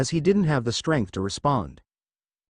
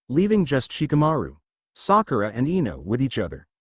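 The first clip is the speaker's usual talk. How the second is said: ordinary delivery, nothing marked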